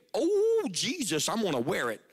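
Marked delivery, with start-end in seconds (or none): 0.1-0.7 sudden high loud yelp; husband realizing the wife is angry; mock alarm. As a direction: yelp like you just got in trouble.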